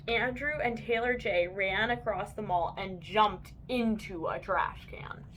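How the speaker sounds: ordinary delivery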